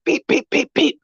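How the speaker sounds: high imitated horn beeps. Driver imitating the car honking at him, high beeps. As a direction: tap out the honks high. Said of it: "it's just going beep".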